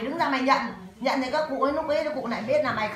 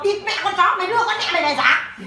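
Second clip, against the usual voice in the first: louder, higher imitated son-in-law swearing; retold cursing; 6 semitones higher, 5 dB louder than usual. Son-in-law cursing, sending the mother back, louder. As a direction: spit out the son-in-law's curse. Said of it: "thằng con rể nó bảo".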